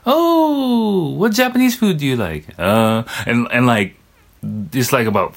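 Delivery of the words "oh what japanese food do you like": much higher mock-surprised falsetto; surprised Japanese staff. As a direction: imitate an amazed Japanese waiter, way up high. Imitating Japanese staff questions.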